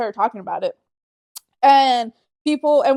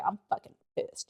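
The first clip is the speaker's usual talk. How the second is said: hushed mock anger; 14 dB quieter than usual. Retold angry reply, quiet.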